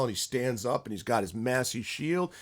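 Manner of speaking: usual speaking voice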